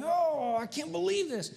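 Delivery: hammed-up irritation at stepping in it; frustrated outburst. Pastor stepping in dog vomit, mock exasperated cry. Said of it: "I am so frustrated, so irritated".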